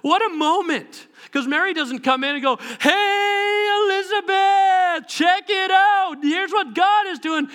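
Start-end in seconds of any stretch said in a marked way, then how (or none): 2.8-5.1 high, drawn-out excited hail; boisterous greeting. Acted. Imagined boisterous greeting, contrasted with plain. 5.1-7.5 high, stretched-out mock enthusiasm; excited announcement. Imagined Mary announcing excitedly.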